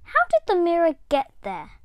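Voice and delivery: high, loud wondering question; puzzled question. Acted. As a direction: ask it high and loud, amazed at the mirror. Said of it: "I asked myself".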